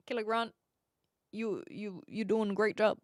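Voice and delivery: usual speaking voice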